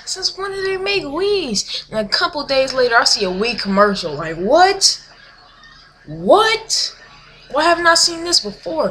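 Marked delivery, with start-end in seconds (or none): 0.1-1.8 higher, slow stunned question; retold disbelief, pitched up and drawn out; shocked disbelief. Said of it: "I'm like". 4.4-8.9 higher, indignant disbelief; mock outrage at being last to know; stunned surprise. Reacting to Wii commercial.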